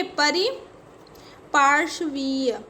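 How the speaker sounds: परिपार्श्वीय very slow, broken after 'pari'; slow dictation. Shown as a demonstration. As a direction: dictate परिपार्श्वीय in drawn-out parts. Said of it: "क्या होता है". (परिपार्श्वीय को तोड़कर बहुत धीरे बोलें)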